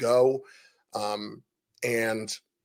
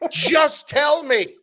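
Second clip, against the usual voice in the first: drawn out slowly, pitched way up, half-laughing; exasperated plea; 7 dB louder, much slower than usual. Retold or imitated. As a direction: beg him to just spit it out. Retold exasperating exchange with Fuch.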